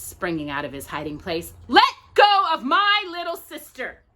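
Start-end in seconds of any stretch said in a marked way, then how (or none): 1.7-4.0 high, loud angry shout; loud furious shout. A narrator's reading. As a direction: yell at the witches. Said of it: "shouted Max".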